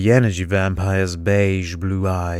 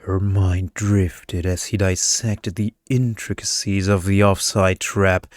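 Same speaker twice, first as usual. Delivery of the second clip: plain reading voice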